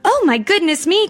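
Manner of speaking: high, alarmed little exclamation; startled cry. A narrator's reading. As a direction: cry out as if just caught. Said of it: "cried Goldilocks".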